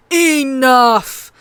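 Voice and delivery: a king silencing the room; bellowing king ending the quarrel; roared. A narrator's reading. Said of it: "the king roared".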